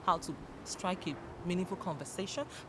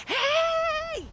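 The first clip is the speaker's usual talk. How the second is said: very high, loud, long "hey"; enthusiastic hail; 5 dB louder than usual. Shown as a demonstration. Showing what hail means to listeners, enthusiastic greeting. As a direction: hail someone with huge enthusiasm. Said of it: "greet or acclaim enthusiastically".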